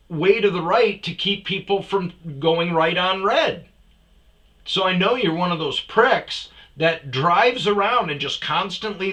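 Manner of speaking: loud, high, heated grilling; angry accusation. Retold or imitated. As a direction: accuse him loudly, pressing for an answer. Retold accusation of other driver.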